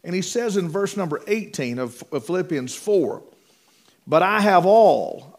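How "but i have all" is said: "all" drawn out and loud; slow, louder quote leaning hard on "all"; stress on "all". Shown as a demonstration. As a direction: land heavily on "all" and stretch it. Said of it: "I want to emphasize the word all".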